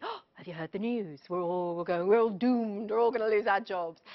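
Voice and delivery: a put-on voice, gossiping eagerly about disaster; gleeful doom goblin. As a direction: relish the bad news like a doom goblin. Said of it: "The doom goblins, well, they love bad news".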